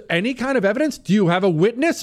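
high, insistent mock cross-examination; challenging. Homeowner demanding proof from the association, high challenging questions. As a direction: press each question like a lawyer.

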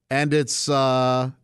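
normal talking voice

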